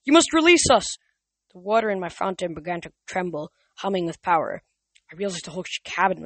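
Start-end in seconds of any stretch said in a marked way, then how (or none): 0.0-0.9 desperate plea. A narrator's reading. Theseus pleads in fear.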